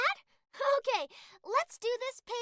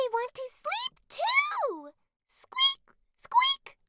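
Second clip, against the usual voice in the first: high squeaky mouse voice, much higher; 14 semitones higher, much slower than usual. Acted. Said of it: "You did a great squeaky voice".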